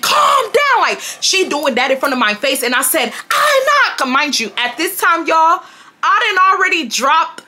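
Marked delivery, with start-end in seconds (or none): 0.0-0.8 very high imitated yell; squealed mock order. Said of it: "she yells again". 3.3-4.1 very high re-enacted refusal; heated mock yell; defiant. Own yelled refusal in quarrel.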